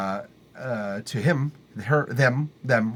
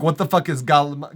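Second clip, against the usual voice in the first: ordinary talk, nothing marked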